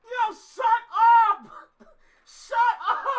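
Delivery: high, loud, drawn-out yell; slow, high holler breaking into laughter; excited disbelief. Real, not acted. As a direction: exclaim it in amazed delight.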